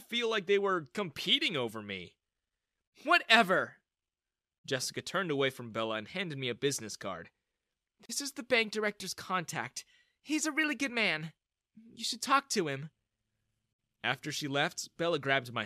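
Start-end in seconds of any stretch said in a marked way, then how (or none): 3.0-3.8 high, loud brush-off; dismissive. A narrator's reading. Mother turning away from arguing daughter, dismissive. 8.1-12.9 higher, composed advising voice; helpful woman. A narrator's reading. Mother handing young man a business card, helpful. Plays mother giving a contact.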